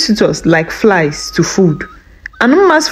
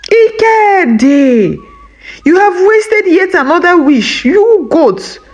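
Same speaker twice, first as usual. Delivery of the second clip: fuming; 9 semitones higher than usual. A narrator's reading. Wife berating husband over a wasted wish, fuming. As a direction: scold in a raised pitch, fuming. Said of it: "Mma fumed".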